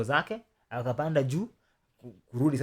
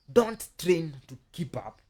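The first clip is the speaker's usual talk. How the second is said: slow, underlined "don't train to keep up"; weighty, drawn-out stress on "don't train"; emphatic warning; 4 semitones higher, 4 dB louder, much slower than usual. Real, not acted. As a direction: stretch out "don't train" slowly and firmly. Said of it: "I underline the word".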